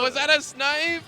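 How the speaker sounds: high, loud, incredulous question; astonished outburst. Real, not acted. Caster asking co-caster about a snipe, astonished and loud. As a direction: shout the question in amazement.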